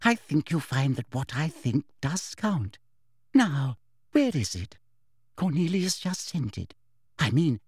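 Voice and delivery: sugary, smug little voice; falsely sweet self-importance; simpering. A narrator's reading. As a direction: simper while rummaging for the proof. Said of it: "simpered Umbridge".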